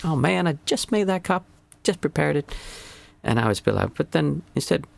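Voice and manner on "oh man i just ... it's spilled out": half-hearted frustrated moan; annoyed complaint. Retold or imitated. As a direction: pitch jumps up on "oh man", a mock exasperated whine. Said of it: "I would have had that feeling".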